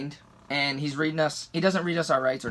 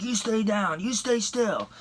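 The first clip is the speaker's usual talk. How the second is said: higher-pitched imitation of a cop; mock stern command, pitched up; cop's order; 6 semitones higher, slower than usual. Cop holding handcuffed driver at gunpoint, imitated command.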